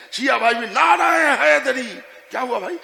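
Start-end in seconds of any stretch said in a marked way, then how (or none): 0.7-1.9 rallying cry. Acted.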